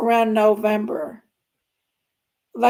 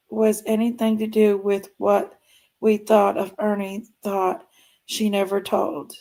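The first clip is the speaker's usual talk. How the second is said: ordinary talk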